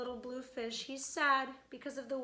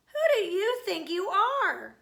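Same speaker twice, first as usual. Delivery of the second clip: loud, high outraged demand; proud Rainbow Fish angrily refusing the little fish; indignant cry; 8 semitones higher, 12 dB louder than usual. Acted. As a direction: put the little fish in his place. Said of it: "cried the Rainbow Fish".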